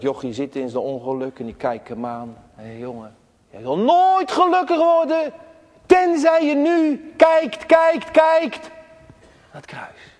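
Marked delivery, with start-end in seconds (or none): 2.5-10.1 put-on high, loud pleading call to the boy; urgent preacher's call. Lay preacher urges the boy in church to look, high and loud. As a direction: call him to look, pressing harder on each kijkt.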